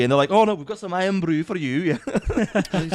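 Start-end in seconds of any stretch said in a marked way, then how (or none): 0.3-2.0 higher-pitched mock Scottish quote; playful put-on Scottish accent. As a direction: imitate a Scottish guide in a joky accent. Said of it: "he finally got an accent there".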